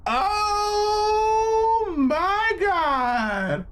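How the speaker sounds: long high shocked "oh my God" wail; stunned viewer wailing at the screen; drawn-out wail. Real, not acted. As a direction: stretch it out in horror, way up high. Shocked reaction to gory scene.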